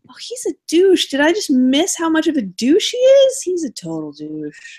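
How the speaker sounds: high-pitched incredulous realization; acted-out dawning shock; mock disbelief. Acts out dawning shock at character.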